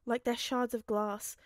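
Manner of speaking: ordinary delivery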